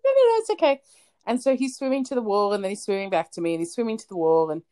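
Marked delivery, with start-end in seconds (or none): 0.0-0.8 high, quick-to-forgive reassurance; retold "don't worry" in a high-pitched voice; imitated reassurance. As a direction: wave off an apology in a raised, louder voice. Reassuring a child's apologetic mum.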